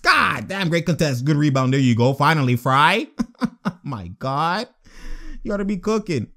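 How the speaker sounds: ordinary talk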